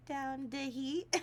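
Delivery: very slow, drawn-out mock plea broken by laughs; playful pleading. Asking her husband to turn down the heat, drawn-out begging. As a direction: stretch every word like begging. Reading a pleading message aloud.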